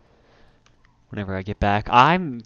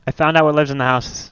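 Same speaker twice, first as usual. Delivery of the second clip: louder, rattled after a scare; shaken by fright; 3 semitones higher, 7 dB louder than usual. Real, not acted. Player tells friend what scared them, terrified. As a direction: report it loudly, still startled. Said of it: "that actually terrified me".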